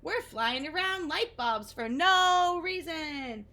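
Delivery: Wiggly giddy, circling the light bulbs. Acted. Moths flying giddily.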